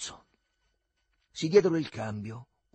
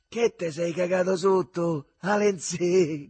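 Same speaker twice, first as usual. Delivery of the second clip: loud, higher, drawn-out taunt; shouted jeer; 5 semitones higher, 6 dB louder, slower than usual. A narrator's reading. Friend lying between the tricycle wheels, jeering shout. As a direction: tease him at a shout. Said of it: "gridava il Riccetto".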